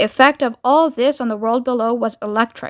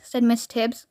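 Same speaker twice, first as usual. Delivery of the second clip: usual speaking voice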